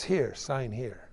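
understated snide shopkeeper voice. Acted. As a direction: slip it in snidely, drawn out. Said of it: "Snidely says".

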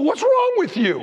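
high, loud incredulous yell; yelling at an addict who keeps using; exasperated shout. Retold or imitated. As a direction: shout at them in disbelief. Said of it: "You go".

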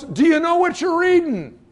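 high, loud shout to someone on a moving chariot; yelled question. Retold or imitated. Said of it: "And he yells".

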